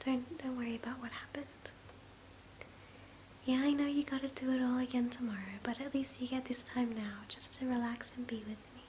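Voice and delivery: ordinary delivery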